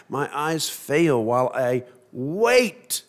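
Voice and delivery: slow down and land hard on "wait"; stress on WAIT. Shown as a demonstration. Preacher pointing out the psalmist's patience, heavy stress on "wait". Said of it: "emphasis on the word wait".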